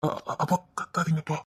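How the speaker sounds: soft, low stuttered plea; nervous stammer. Acted. Son begging his shouting father to quieten down, nervous stammer. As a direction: stammer it softly, trying to hush him.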